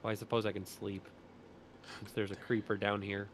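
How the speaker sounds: ordinary talk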